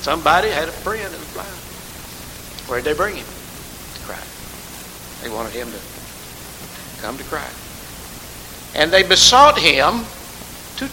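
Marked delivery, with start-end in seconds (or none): 3.2-7.6 breathy hushed whisper; whispered, reverent aside. Acted. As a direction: drop to a whisper on the answer. Hushed answer in sermon.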